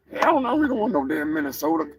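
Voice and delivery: normal talking voice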